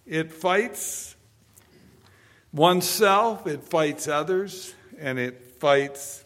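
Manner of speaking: slow, loud, high preacher's cry; booming sermon delivery; full force preaching. Acted. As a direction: hammer each line home to the congregation. Preaching climax.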